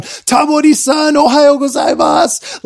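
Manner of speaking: mock shout. Workers screaming down greeting.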